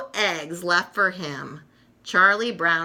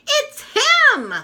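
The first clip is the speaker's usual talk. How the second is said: much higher, louder thrilled cry; excited gasp; 7 dB louder than usual. Acted. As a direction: burst out with joy at the sight. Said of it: "Linus gasps".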